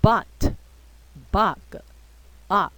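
"butt、bug、up" said with an open "啊" vowel; "butt" with an "ah" sound. Shown as a demonstration. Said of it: "会念成 比较偏向啊的发音".

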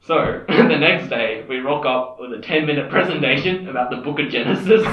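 loud, laughing through the words. Real, not acted. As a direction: laugh while telling the punchline.

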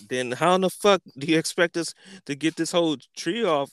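incredulous. Retold or imitated. Baffled at no tools.

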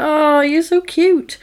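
high, slow mock coo. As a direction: gush fondly, drawing it out up high. Teller coos over the child.

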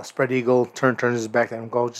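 usual speaking voice

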